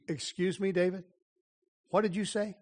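soft, careful query; hushed incredulous aside. Pretend. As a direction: question the king under your breath. Stepping back to question David.

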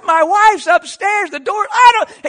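high, loud frantic protest; frantic shouting. Retold or imitated. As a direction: yell your excuse over the other man. Acts out his own frantic argument.

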